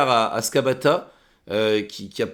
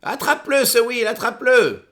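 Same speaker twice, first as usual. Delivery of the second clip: much higher, bossy villain voice; high commanding NPC voice; 11 semitones higher, 3 dB louder than usual. Acted. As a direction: bark orders in a high voice. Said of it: "Elle fait".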